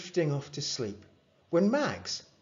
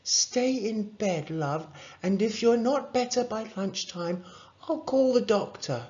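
put-on a parent tending a child sick in bed; higher-pitched mum, taking her time; caring mum; 10 semitones higher than usual. A narrator's reading.

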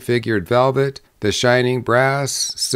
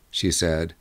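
ordinary delivery

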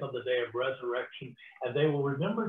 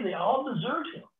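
usual speaking voice

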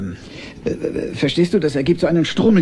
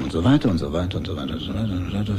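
fast, softer, lower rattle; hurried skimming; 8 semitones lower, 5 dB quieter, faster than usual. Acted. Father skips through the bedtime story, rapid dismissive rattle. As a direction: rush through the rest as filler.

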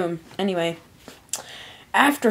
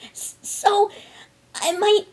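high, halting, stammered question; pitched-up, broken, tearful voice; on the verge of sobbing; 4 dB louder than usual. A narrator's reading. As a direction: falter as tears come on. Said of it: "She began to sob".

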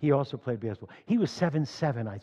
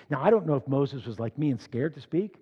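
ordinary talk

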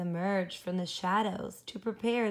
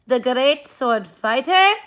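loud challenge; 5 semitones higher, 12 dB louder than usual. Acted. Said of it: "asked Poquito Tito".

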